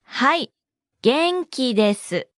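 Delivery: はい, a pause, then 元気です spaced out; slow model. Shown as a demonstration. Teacher breaks down the response for learners, slowly.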